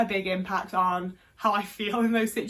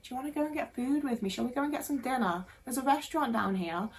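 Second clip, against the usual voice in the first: mock smooth pickup line; 2 semitones higher, 5 dB quieter than usual. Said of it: "then be like".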